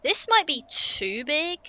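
ordinary talk

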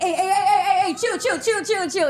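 high, repeated mock protest through laughter; bodyguards stepping in. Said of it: "Maximo, and Norby like".

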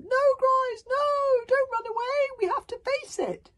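very high, loud desperate cry; crying out after them. A narrator's reading. Tsar begs fleeing friends to stay, crying out. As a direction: beg the others to stop. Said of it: "Tsar crying after them".

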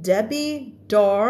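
"Debbie Dar" broken off on a clear R; R sounded in DAR. Shown as a demonstration. Teacher asking if students hear it, "Dar" with R. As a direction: hold the English R ending "Dar". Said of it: "เธอได้ยินเสียง R ไหม".

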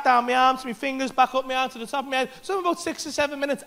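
ordinary delivery

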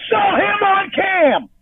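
yelling at Jamie through tears; furious crying shout. Real, not acted. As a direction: scream it through tears at her.

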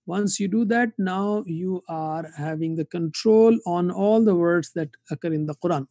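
usual speaking voice

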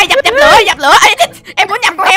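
high, loud firefighting yells; frantic shout. Acted. Neighbour battling the pigsty fire, frantic. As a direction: shout in a flap.

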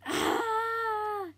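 long high open Ah sung out; loud ah. Acted. Penelope Periwinkle teaching breathing, loud long Ah. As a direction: let out a big high Ah. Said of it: "say, 'Ah!'".

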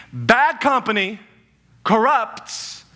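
very slow, word by word; slow emphatic. Shown as a demonstration. Preacher teaching the verse slowly. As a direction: lean hard on each word. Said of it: "Etch this into your minds".

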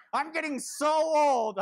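hammed-up woe is me; mock self-pity. Shown as a demonstration. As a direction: draw out "I'm getting so old" like a sulking kid. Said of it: "I know, woe is me".

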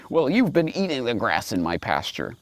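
ordinary delivery

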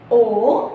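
او with the mouth wide open. Shown as a demonstration. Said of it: "نفتح بقنا واحنا بنقولها". (او بفتح الفم)

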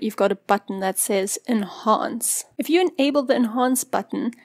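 normal talking voice

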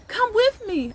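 put-on a child tugging a neighbour's hand, begging. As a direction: beg him like an excited child. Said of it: "I beg, tugging at his hand".

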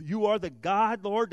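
normal talking voice

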